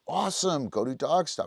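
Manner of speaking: high, cheerful send-off; praise, then send the dog away; upbeat trainer dismissal; bright praise. Shown as a demonstration.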